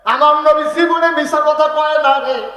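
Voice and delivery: high, drawn-out proclamation; impassioned shout. Acted. Abu Bakr defends the Prophet's truthfulness, long high shout. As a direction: cry it out at full force. Avowal at full force.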